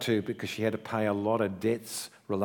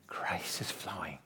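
whispered; 5 semitones lower, 10 dB quieter than usual. Real, not acted. Hushed sermon emphasis.

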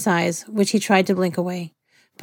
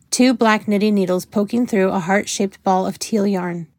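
usual speaking voice

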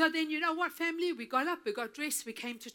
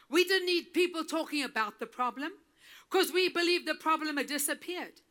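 ordinary delivery

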